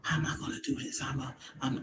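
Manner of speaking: whispered, fast self-talk; unvoiced quick muttering under the breath; whispering to herself. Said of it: "now I'm talking to myself".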